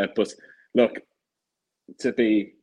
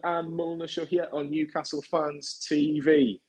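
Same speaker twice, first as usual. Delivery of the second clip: bright, raised presenter greeting to open the show; upbeat show opener; 7 semitones higher than usual. Real, not acted. Host welcoming fans to the episode, upbeat presenter greeting.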